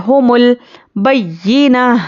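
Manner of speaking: slow, melodic tajweed recitation; measured Quranic chant. Shown as a demonstration. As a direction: chant it slowly like a qari. Recites verse fragment.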